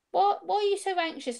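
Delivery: high-pitched retold query, rising on What; puzzled questioning. Police officer asking why she is so anxious, puzzled.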